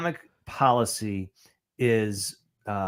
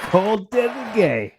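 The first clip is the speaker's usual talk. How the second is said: higher, louder mimicry of a defiant speech; mock the big applause line; mock rally speaker; 7 semitones higher, 3 dB louder, slower than usual. Co-host parroting the convention speaker's applause line, mock oratory.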